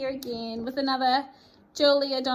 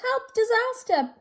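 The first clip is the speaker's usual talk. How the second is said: alarm; 10 semitones higher, 6 dB louder than usual. Acted. Creatures see Baby Toad in danger.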